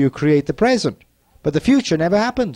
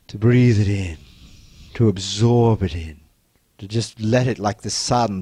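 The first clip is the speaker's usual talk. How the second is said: guided meditation; 7 semitones lower than usual. Acted. Leads an inner embracing exercise.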